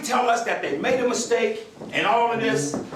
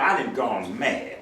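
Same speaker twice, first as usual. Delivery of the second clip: ordinary delivery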